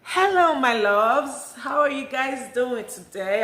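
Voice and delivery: loud, bright hello to the fans; excited greeting. Real, not acted. Vlogger greeting her viewers with a loud excited hello. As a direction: burst in cheerfully with the hello.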